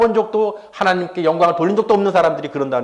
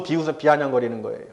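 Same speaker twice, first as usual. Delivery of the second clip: ordinary talk, nothing marked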